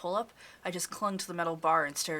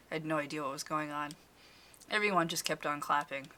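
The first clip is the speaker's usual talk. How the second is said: ordinary delivery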